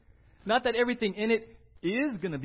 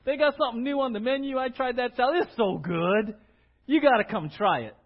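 louder, eager rave about a meal; enthusiastic recommendation; 4 semitones higher, 8 dB louder than usual. Pretend. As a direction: sell your friend on the new salad.

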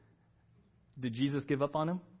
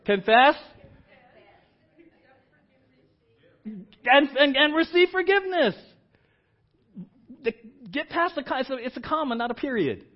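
loud, high, ringing sermon climax; full-force preaching; 10 semitones higher, 8 dB louder than usual. Acted. Pastor urging the church to confess, loud preaching. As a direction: preach it hard and lift the room.

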